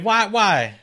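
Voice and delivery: high, loud pleading whys; mock incredulity. Host begs ghost-story fans for a reason, mock incredulous. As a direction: plead for a reason in comic disbelief.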